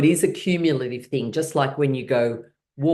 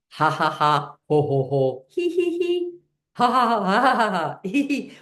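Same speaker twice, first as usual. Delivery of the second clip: ha, ho and he chanted, the he's much higher; playful laughing syllables, he much higher; laughter syllables; 7 semitones higher than usual. Shown as a demonstration. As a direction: sound out each laugh syllable. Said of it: "make laughing sounds".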